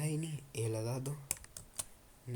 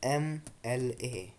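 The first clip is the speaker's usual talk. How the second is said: slow spelled-out letters; 4 dB louder, much slower than usual. Shown as a demonstration. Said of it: "अब मैं आपसे कहता हूँ".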